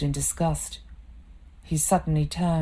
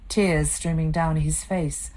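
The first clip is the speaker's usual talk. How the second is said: ordinary delivery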